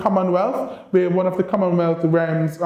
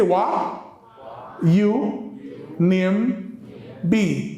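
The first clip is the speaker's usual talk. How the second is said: Creole question spaced out one word at a time; a drill in Kittitian Creole for learners to repeat; much slower than usual. Shown as a demonstration. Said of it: "Let me hear you say".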